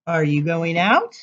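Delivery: ordinary delivery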